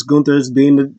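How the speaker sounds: ordinary talk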